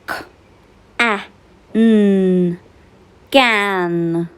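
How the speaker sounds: k, a, p, then blended into cap; sounding out words. Shown as a demonstration. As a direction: say each letter sound with pauses, then blend. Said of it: "Let's practice and blend the sounds".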